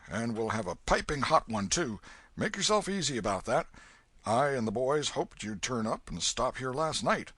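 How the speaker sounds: put-on loud, hearty old Welshman; hearty old man. Old Welshman promises Huck breakfast, hearty and loud. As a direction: fuss over a hungry boy.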